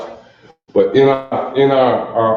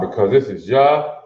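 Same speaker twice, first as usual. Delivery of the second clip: usual speaking voice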